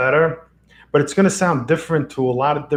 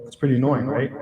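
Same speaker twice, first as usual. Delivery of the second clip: ordinary delivery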